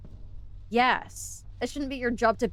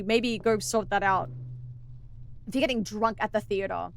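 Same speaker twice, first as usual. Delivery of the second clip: usual speaking voice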